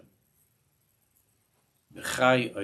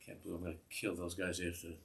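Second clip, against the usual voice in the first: softer, muttered mock threat under the breath; mock annoyed grumble; 3 semitones lower, 9 dB quieter than usual. Annoyed man in the sukkah grumbling about the electrician, soft mock mutter. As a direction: grumble like a fed-up guy in the sukkah. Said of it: "And you're annoyed because".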